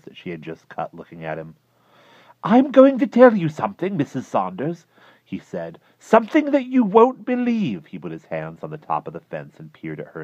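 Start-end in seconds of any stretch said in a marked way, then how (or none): 2.4-4.9 much higher and louder, intense; tense high voice. Acted. Klausner confiding in his neighbor, feverish. 6.0-7.9 much higher and louder, intense; tense high voice. Acted. Klausner confiding in his neighbor, feverish.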